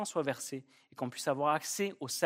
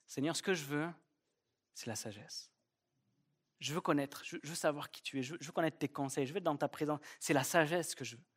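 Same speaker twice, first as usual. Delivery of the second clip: humble prayer; 8 dB quieter than usual. Acted. Solomon's humble prayer to God.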